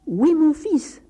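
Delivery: insistent father, loud and high. Acted. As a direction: call it out to someone hard of hearing. Father repeats demand to deaf priest.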